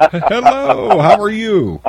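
very high, sing-out hello; exuberant greeting. Real, not acted. Host welcomes a friend of the show, high exuberant greeting. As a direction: greet them in a high, delighted swoop.

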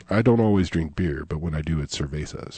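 low, soft, suave spokesman voice; beer ad pitchman. Acted. Teasing a co-host with a beer ad impression, low suave voice. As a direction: drop low and hush it like a beer ad. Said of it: "You sound, you sound like when I".

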